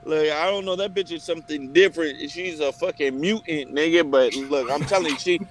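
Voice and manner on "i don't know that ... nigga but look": ordinary delivery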